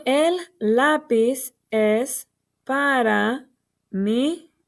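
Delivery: slow, separated words, each given room; word-by-word reading. Shown as a demonstration. Teacher reading the finished sentence to children, slow and word by word. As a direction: read it out one word at a time. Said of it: "fíjense cómo voy siguiendo las palabras para leerlas".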